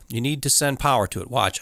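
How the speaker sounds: ordinary talk, nothing marked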